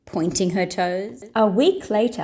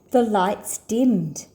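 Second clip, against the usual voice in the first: ordinary delivery, nothing marked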